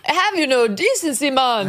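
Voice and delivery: high, slow mock Jamaican accent; imitating Jamaican accent. Host mocking Kamala's Jamaican accent, high and slow. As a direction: ham up a bad Jamaican accent to mock her. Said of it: "doing a Jamaican accent".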